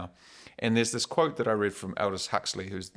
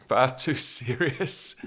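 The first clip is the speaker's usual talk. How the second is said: laughing; 4 semitones higher, 4 dB louder, slower than usual. Real, not acted. Laughing at own stumble.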